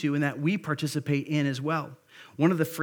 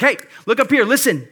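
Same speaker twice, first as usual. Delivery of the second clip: louder, higher shout to grab the room; loud call to attention; 6 semitones higher, 8 dB louder than usual. Acted. Preacher voicing Jesus calling the crowd, loud shout. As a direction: bark it out to snap every head up. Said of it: "that's what he's saying".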